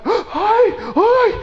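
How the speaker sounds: very high, drawn-out mock cry of alarm; a mum panicking at her child's bump; alarmed mother. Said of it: "se perturba". (madre alarmada, grito agudo y alargado de susto fingido)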